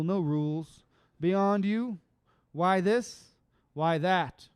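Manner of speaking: loose rhythmic poetry reading; casual spoken word cadence; spoken word poem. Acted. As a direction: riff through the lines.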